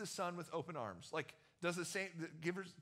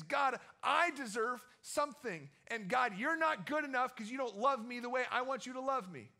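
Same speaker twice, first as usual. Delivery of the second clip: higher, louder aggrieved complaint to God; frustrated prayer; 7 semitones higher, 6 dB louder than usual. Retold or imitated. Preacher voicing his own complaint to God, higher and louder. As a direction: list your good deeds, then demand. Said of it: "what I'm saying is".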